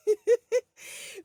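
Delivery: high, loud giggle; real burst of high laughter; amused laugh. Real, not acted. Amused by hook-up request.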